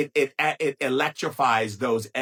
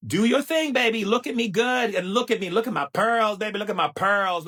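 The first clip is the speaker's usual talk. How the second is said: higher, louder sassy diva showing off; sassy diva strut; 7 semitones higher, 3 dB louder than usual. Acted. To staring Norwegians in the corner, a loud sassy diva brag. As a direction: flaunt your pearls at them, baby.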